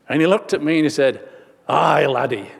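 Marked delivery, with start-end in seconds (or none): 1.7-2.5 imitated old Scotsman; Scottish accent. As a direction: put on a Scottish lilt. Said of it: "Still had his Scottish accent".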